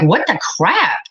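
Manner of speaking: usual speaking voice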